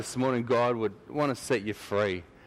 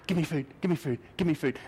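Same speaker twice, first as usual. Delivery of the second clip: higher, insistent yappy begging; yapping dog; 5 semitones higher, faster than usual. Acted. Annoying dog begging at your doorstep, high yapping. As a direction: yap it out like a pestering dog. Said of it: "he's like this annoying yapping dog".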